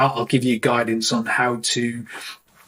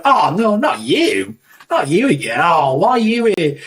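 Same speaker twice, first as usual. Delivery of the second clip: playful groaning complaint, pitched up; teasing, exaggerated dismay; mock dismay; 5 semitones higher than usual. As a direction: act appalled to see a friendly rival. Acted banter with a photographer.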